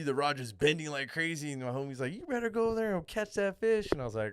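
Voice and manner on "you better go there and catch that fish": higher-pitched, lightly put-on buddy voice; imitated urging friend. Angler quoting his homie at the bending rod, mock urging.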